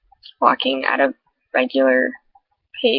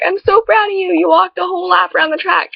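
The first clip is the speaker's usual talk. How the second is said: excited praise; 7 semitones higher than usual. Retold or imitated. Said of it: "she's like".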